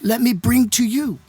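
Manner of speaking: high, ringing announcer delivery; imitated stage introduction. Star introducing friend to the crowd, high announcer voice. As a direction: present a guest to a big crowd, pitched high.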